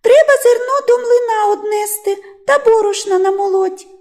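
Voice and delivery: high, piping mouse voice; squeaky chorus of little mice. A narrator's reading. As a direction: pipe up as tiny mice. Mice pipe up in story.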